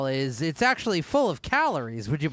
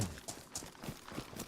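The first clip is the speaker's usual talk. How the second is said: giggling; 5 semitones lower, 5 dB quieter than usual. Real, not acted.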